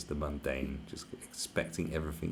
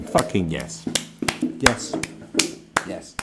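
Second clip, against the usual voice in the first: loud cheer, louder; mock cheering; 8 dB louder, slower than usual. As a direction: cheer like a crowd giving an ovation. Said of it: "standing ovation, like, going".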